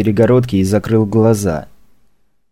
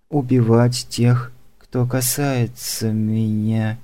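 slow, softer, higher and dazed; drugged trance voice; 3 semitones higher, 4 dB quieter, slower than usual. Acted. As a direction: murmur it half asleep, like a trance. Drugged assassin confessing in trance.

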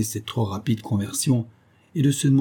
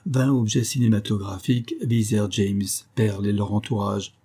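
plain reading voice

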